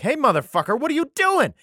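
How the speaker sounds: mock-outraged divine scolding; indignant yell. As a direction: shout it like catching someone red-handed. Voicing God scolding the prophet.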